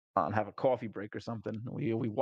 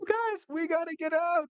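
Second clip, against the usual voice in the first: much higher, slow, alarmed; high panicked warning; 3 dB louder than usual. Retold or imitated. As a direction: warn the party as the floor gives way. Floor collapsing beneath cart.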